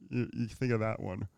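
ordinary talk

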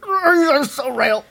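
high slow mocking voice; mock an angry commenter. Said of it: "they're commenting".

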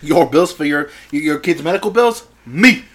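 loud, high gangster bragging; Scarface. Acted. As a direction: assert power like a ranting drug lord. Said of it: "he's just sitting there like Scarface".

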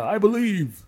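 high preachy "I believe"; a lightly hammed churchy believer; mock believer. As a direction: mimic a devout churchgoer.

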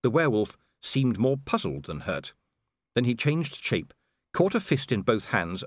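ordinary delivery

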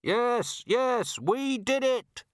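high, desperate calling out; shouted confession. A narrator's reading. Trapped robber confessing from the building, shouting. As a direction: call it out high, dragging the last words. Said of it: "shouted a voice from the building".

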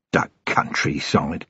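low, slow, disgusted grunt. A narrator's reading. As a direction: grunt it out slowly, low and soft. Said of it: "Vimes grunted".

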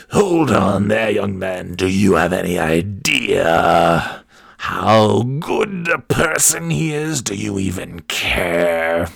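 very slow, stretched-out mock reproach; scolding church woman. Church woman confronting Father John's kid, mock scolding. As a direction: drag the words out, swooping up in indignation. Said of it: "these women would just come up".

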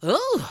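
high, loud mock groan of overwhelm; exasperated groan. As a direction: let out a burst of frustration. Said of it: "I was like".

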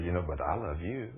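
husband quietly calming his upset wife; gentle loving. Shown as a demonstration. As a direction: soothe her gently, close up. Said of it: "gently and lovingly and kindly and say".

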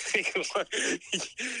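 soft breathy laugh answering the phone; real giggle. Real, not acted. Friend answering the call, real laugh. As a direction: caught laughing before hello. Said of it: "Why are you already laughing?".

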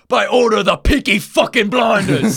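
loud gangster yell in the face; gangster shout. Retold or imitated. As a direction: scream it like a thug. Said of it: "they just go".

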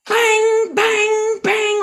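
loud, high imitated clang; banging noise. Banging a pan to distract the dog, loud clanging imitation. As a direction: belt out each bang like a cymbal crash.

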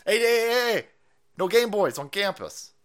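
scolding teacher. Retold or imitated.

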